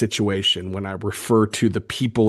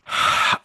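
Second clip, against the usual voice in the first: short, voiceless sigh; real sigh after a maddening read; exasperated sigh. Real, not acted. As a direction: let out a quick breath of exasperation. Exasperated after reading the reply.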